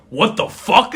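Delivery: a put-on shout of alarm; mock startled shout; imitated startled yell. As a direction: bark it like a caught-off-guard worker. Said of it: "there's just guys like".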